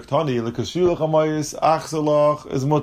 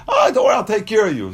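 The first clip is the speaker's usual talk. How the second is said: higher, a little louder, soothing seller; breezy reassurance; 7 semitones higher, 3 dB louder, faster than usual. Retold or imitated. Seller waving away the buyer's concern, higher breezy reassurance. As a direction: brush off the worry to close the sale. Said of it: "So he says".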